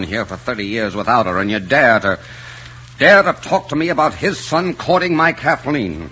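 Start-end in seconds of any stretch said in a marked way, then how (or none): 3.0-6.1 higher, indignant outburst; pitched up in offended disbelief. Acted. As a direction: throw the dare back at him, voice rising. Indignant quarrel over the suitor.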